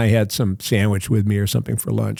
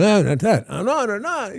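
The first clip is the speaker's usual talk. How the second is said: tripping and crazy; 10 semitones higher, 3 dB quieter than usual. Retold or imitated. Actor covering a forgotten line, acting tripping and crazy. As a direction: play it spaced-out, looping and high-pitched. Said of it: "acted like I was, you know, tripping and crazy".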